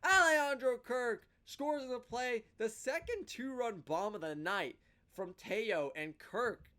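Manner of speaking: loud, high home-run call that builds the line score; excited play-by-play. Acted. Fan recapping the Jays win, in an excited play-by-play call. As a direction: call it like the radio guy at the final out.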